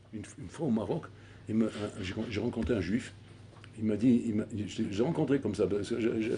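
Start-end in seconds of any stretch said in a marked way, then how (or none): none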